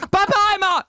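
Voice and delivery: high, loud, sing-song farewell; overly elaborate goodbye. Retold or imitated. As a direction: wave him off with a big high bye-bye. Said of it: "No, actually, I didn't go like that".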